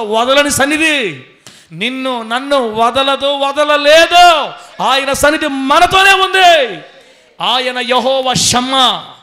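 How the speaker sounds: loud, slow, rising sermon climax; preaching at full force. Real, not acted. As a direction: build to a shouted proclamation.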